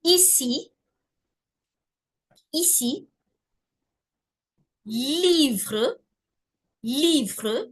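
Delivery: slow French model words with a long "ee"; ici, livre, fille, ville, finir held on "ee"; each word apart, stretching the "ee"; stretched i demo. Shown as a demonstration. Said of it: "a stretched E".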